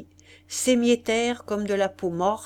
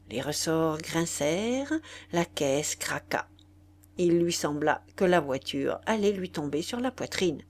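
ordinary delivery, nothing marked

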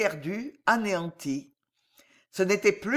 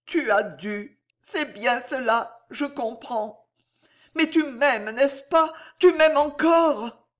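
resigned at first, rising to a high, loud plea; heroine's appeal building in pitch and force; anguished woman in love; 6 semitones higher, 4 dB louder, slower than usual. A narrator's reading. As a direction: accept it, then beg to be loved. Voices Corisandre in the story.

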